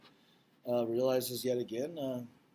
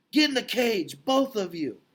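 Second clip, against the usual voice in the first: shouted high and loud, barking the order; angry shout; 7 semitones higher, 4 dB louder than usual. Acted. Mac shouting at the elephants to get in the cage, a loud angry order. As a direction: bark at them to get in the cage. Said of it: "Mac shouts".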